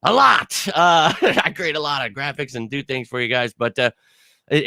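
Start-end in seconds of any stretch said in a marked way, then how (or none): none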